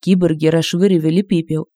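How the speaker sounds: ordinary delivery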